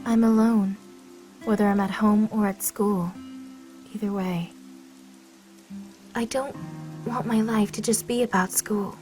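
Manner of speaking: low, soft and subdued; dejected, voice sunk low; downcast confession. Acted. Lonely confession to the other student.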